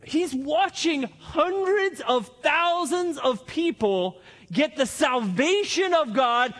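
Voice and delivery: normal talking voice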